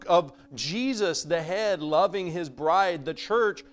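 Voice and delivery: high, loud pulpit preaching; fervent sermon build; full-force preaching. Acted. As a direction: drive the message home to the congregation.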